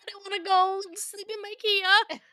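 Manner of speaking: high, slow frightened whine; scared whine. Real, not acted. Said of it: "Oh, I'm scared".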